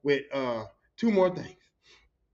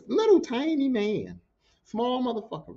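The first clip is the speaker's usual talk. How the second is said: ordinary delivery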